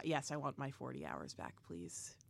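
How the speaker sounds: soft, breathy whispered plea; whispering. Real, not acted.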